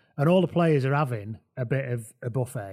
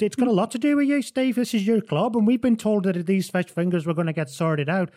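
high, louder, hurried accusation; indignant protest; 7 semitones higher, 4 dB louder than usual. Retold or imitated. David confronts Steve about his club, high and indignant. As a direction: insist it is his responsibility.

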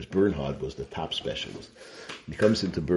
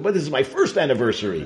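cheeky punchline; 9 semitones higher, 7 dB louder, faster than usual. Retold or imitated. Husband explains the joke.

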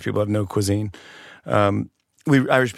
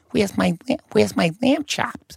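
whining mom; 10 semitones higher than usual. Retold or imitated. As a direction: imitate mom wailing for her dinner. Mom demanding her food in story.

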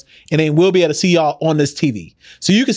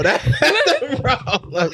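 laughing; 5 semitones higher, slower than usual. Real, not acted. Laughing at getting guest drunk.

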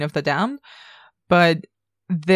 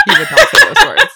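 laughing; 10 dB louder than usual. Real, not acted. Laughing indignation over Lestat.

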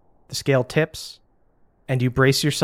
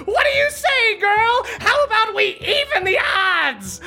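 very high-pitched witch's holler; yelling for all to hear. Acted. Witch on broom challenges the crowd, yelling. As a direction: call out brazenly over a whole crowd. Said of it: "yelling for everyone to hear".

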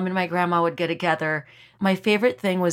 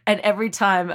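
ordinary talk, nothing marked

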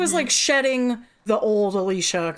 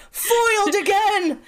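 high slow laughing villain cry; sputtering foiled villain; 10 semitones higher, slower than usual. Retold or imitated. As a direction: shake your fist and wail it. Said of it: "shaking his fist in the air".

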